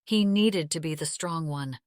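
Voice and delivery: plain reading voice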